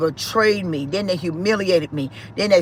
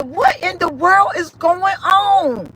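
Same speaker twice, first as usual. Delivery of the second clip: much higher and slow, a crying gasp of disbelief; shocked cry; 11 semitones higher, 3 dB louder, slower than usual. Retold or imitated. Mother seeing her son chained up, crying out high and slow. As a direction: let the words break out in horror.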